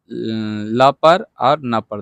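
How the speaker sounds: ल पर और न पर spoken very slowly, each part weighted; teacher's deliberate slow emphasis; slow emphatic listing. Shown as a demonstration. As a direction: point out ल and न one by one. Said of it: "दो गो पर आधा आधा है".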